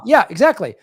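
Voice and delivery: normal talking voice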